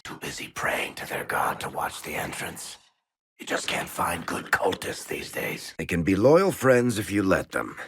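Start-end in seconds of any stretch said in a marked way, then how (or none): 0.0-5.7 hushed whisper, no voice. Acted. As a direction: breathe it so the guards won't hear. Sneaking past praying cultists.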